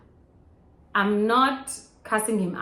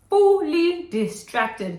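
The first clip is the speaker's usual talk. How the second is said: fully distracted, much higher, louder and very slow; high drawn-out emphasis; 10 semitones higher, 8 dB louder, much slower than usual. Real, not acted. Telling viewers how distracted she was in church, high and slow. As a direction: stretch it out high for comedy. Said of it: "fully distracted during the service".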